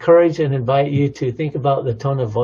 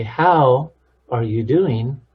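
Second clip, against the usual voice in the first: "How" drawn out and punched, then a beat; stress on How; slower than usual. Shown as a demonstration. Showing a different emphasis of one question, "How" stressed. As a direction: lean hard and long on "How", slowly. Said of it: "that's a different emphasis".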